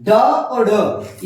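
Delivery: ड and ढ drawn out very slowly, tongue curled back; dotted retroflex demo. Shown as a demonstration. Teacher slowly sounding ड और ढ for students. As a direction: stretch ड और ढ slowly so every sound lands. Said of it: "इसका भी उच्चारण स्थान मूर्धा ही होता है।".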